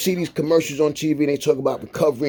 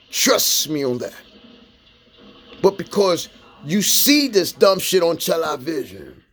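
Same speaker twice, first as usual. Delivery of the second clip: ordinary talk, nothing marked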